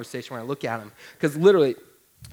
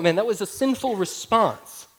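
ordinary delivery

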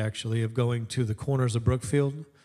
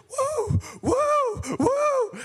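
high, loud running "woo"; excited whooping; 4 dB louder, much slower than usual. Retold or imitated. Preacher mimicking a fan running round the corner, excited whooping. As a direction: whoop shrilly like a runaway fan. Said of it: "he's running like he's running in church".

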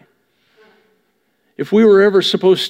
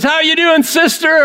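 much higher, bright mock-friendly hello; chirpy church greeter; 9 semitones higher, 3 dB louder than usual. Churchgoer greeting a sister on Sunday, chirpy and high. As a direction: play the cheery churchgoer at the door.

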